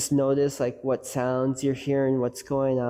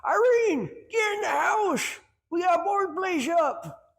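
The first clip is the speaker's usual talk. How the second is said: much higher, mock old grandpa calling; a hammed-up old grandpa, pitched way up. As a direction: call out to Grandma as an old man would. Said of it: "Grandpa's sitting there like".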